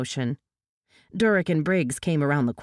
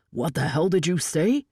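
demanding. A narrator's reading. Captor startled by her plea.

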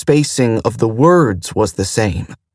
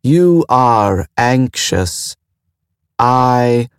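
slow, even and detached; a calm ship mind, slowly measured; much slower than usual. A narrator's reading. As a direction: weigh each word with cool calm. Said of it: "The very calm voice came from the speakers".

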